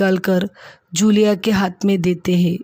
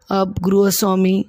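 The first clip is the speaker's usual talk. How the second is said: ordinary delivery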